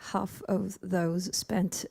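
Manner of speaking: ordinary talk, nothing marked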